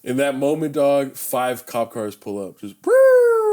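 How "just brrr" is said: loud, high rolled brrr; sudden piercing car noise; imitated siren sound. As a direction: trill like cop cars screeching up. Imitates cop cars pulling up.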